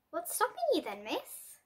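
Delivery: plain reading voice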